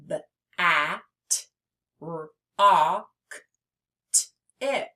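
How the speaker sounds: bat, rock, tip broken into separate sounds; sounding out. Shown as a demonstration. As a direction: isolate each consonant and stretch the short vowel. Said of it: "Each of these short syllables has a vowel".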